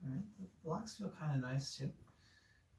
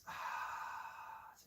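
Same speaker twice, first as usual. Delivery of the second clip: long, soft breathy exhale; long sigh; 7 dB quieter than usual. Real, not acted. Yoga teacher stretching out with the class, long soft sigh. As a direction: let out a slow releasing sigh.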